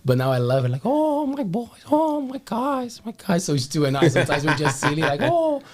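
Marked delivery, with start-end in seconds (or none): 0.8-3.4 put-on high, slow doting old woman; imitating an old lady fussing over her boys. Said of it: "voices of older people". 5.2-5.6 high, sighing granny oh; a doting old woman's sigh; silly old lady sigh. Retold or imitated. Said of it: "doing these voices".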